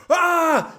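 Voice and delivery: high, loud "ah" outburst; imitated exasperated dad yell; mock yell. Father on the phone during an aftershock, loud mock yell. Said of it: "now he is yelling at me".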